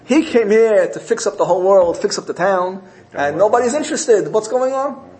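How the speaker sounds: high-pitched retold indignation; very upset. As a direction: voice the preacher's baffled, upset protest. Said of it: "He's very upset".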